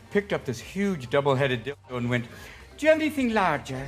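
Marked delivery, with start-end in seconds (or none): 2.8-3.9 put-on prim old lady. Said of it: "dressed as her".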